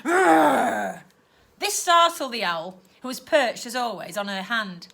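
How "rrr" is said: loud guttural growl; aunt growling in frustration; long growl. Acted. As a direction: growl like an angry beast. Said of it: "let out a long growl".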